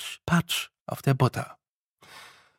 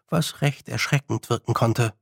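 ordinary delivery, nothing marked

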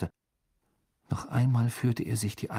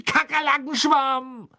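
very high, loud, drawn-out yell; long furious cry at top pitch; angry shout; 6 dB louder, slower than usual. A narrator's reading. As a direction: scream it out in exasperation. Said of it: "schrie er zornig auf einem Bein hüpfend".

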